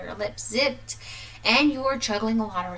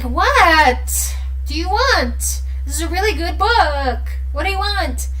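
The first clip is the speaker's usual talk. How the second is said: higher, louder, slow irritated demand; annoyed snap; 8 semitones higher, 9 dB louder, slower than usual. Retold or imitated. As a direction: drag it out, fed up with being interrupted. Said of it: "And you're like".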